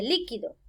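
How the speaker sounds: líquido as LÍ-qui-do; accent the opening LÍ of líquido; stress on first syllable. Shown as a demonstration. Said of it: "antes de la penúltima sílaba".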